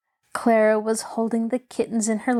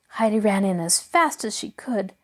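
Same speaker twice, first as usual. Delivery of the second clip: usual speaking voice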